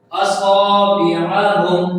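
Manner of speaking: slow اصابعہم with the saad vowel held long; lengthened saad. Shown as a demonstration. Teacher models the word, slow chanted اصابعہم with long saad. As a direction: stretch the "saa" in اصابعہم. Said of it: "تھوڑا سا لمبا کرنا".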